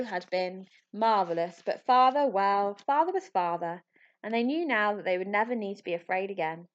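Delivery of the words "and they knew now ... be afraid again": ordinary delivery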